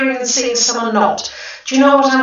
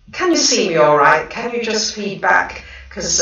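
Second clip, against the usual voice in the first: ordinary talk, nothing marked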